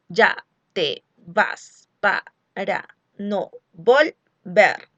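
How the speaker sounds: very slow, every syllable pulled apart; drawn-out metrical reading of the verse; scanning syllables. Shown as a demonstration. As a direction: count out the metre syllable by syllable. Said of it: "contemos número de sílabas".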